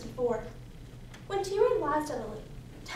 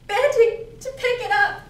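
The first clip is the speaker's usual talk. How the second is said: higher and louder, building to disaster; tense dramatic narration; 5 semitones higher, 9 dB louder than usual. Acted. Daughter reliving picking up the gun, urgently. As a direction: push it urgently toward the coming shot. Reliving the moment before the shot.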